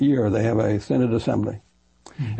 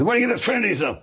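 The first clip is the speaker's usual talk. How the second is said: high-pitched, incredulous challenge; mock challenge; 10 semitones higher, faster than usual. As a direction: put on an objector's indignant question, pitched high. Voicing scornful objectors in retelling.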